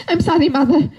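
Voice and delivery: put-on laughing Soviet boy; Soviet accent. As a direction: apologize to mother in a Soviet accent, half laughing. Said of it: "Soviet boy starting it up".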